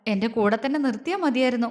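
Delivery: ordinary delivery, nothing marked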